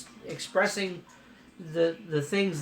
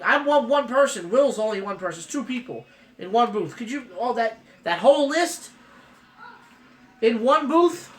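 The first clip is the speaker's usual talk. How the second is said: excited; 6 semitones higher, 5 dB louder than usual. Real, not acted. Overwhelmed by the huge list.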